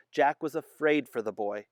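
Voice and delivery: ordinary delivery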